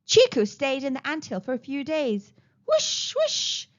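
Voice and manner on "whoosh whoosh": very high breath-driven whooshes; wind whoosh. Acted. Trees and wind howling, imitated whoosh. Voiced wind sound effect.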